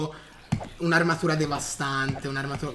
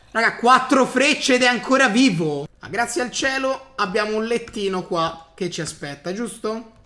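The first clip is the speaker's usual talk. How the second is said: normal talking voice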